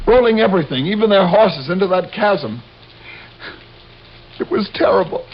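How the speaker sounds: reliving the avalanche, barely holding together; distraught. Acted. Survivor telling the men how his wife died, distraught and rising.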